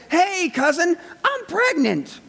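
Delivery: high, breezy mock greeting to a cousin; casual chirpy greeting. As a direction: toss it off like casual gossip. Casual imagined greeting from Mary.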